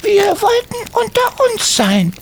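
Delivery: put-on very high, hesitant child's voice; a kid trying to wriggle out; evasive boy. Tommy makes excuses.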